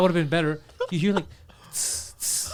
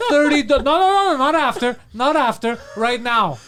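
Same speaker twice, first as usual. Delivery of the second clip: higher and louder, laughing, firm; insistent pimp; 7 semitones higher, 6 dB louder than usual. Retold or imitated. Poseidon insists latecomers pay now, high and loud. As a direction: shut down any haggling.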